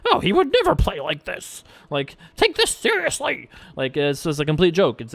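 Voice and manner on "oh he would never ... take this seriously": lightly voiced sneering commenter, much higher; mock the doubting viewers in a higher voice. Quoting YouTube commenters, a lightly mocking higher voice. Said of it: "people are like".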